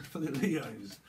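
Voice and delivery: ordinary talk, nothing marked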